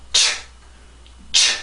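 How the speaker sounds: "치" as a voiceless puff of air only; 순수한 자음 소리. Shown as a demonstration. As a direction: fire off "치" with breath, no vowel. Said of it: "이 발음의 순수한 소리가 앞에 많이 나오게". ("치"를 모음 없이 공기만 세게 터뜨려 발음)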